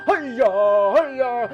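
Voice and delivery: cartoonish mock katana yells; mock sword cries. [name] mimics Jagoro's katana again, mock yells. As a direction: swing an imaginary sword. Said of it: "JoJo character".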